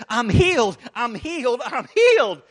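loud joyful repeated cry; retold waitress shouting she is healed; excited cry. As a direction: burst out in disbelieving joy. Waitress bursts out after healing.

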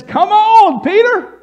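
high, loud call across distance. Acted. Jesus calling Peter onto the water, loud beckoning. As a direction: call him out over the waves.

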